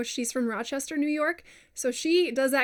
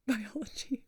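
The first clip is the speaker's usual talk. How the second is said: laughing; 7 dB quieter than usual. Real, not acted.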